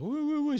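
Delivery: very high, loud repeated "wou-wou" whoop; mock war whoop. Joker passing colleagues in the corridor, mock whooping. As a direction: imitate a cartoon war cry, high and loud.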